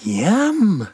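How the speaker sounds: high squeaky yum; a tiny creature's delighted squeak; sandfly squeak. Acted. Sandy tasting the girl, high squeaky yum. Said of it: "said Sandy".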